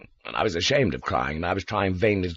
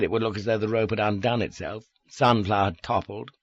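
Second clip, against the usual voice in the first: plain reading voice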